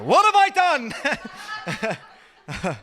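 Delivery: loud, high comic outburst; mock wail. Comic protesting to his audience, loud mock wail. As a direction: cry it out, pitch shooting up.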